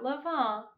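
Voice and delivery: Levin said as luh-VAHN; another wrong pronunciation. Shown as a demonstration. Vlogger rejecting another bakery pronunciation, Levin as luh-VAHN. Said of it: "not Levin".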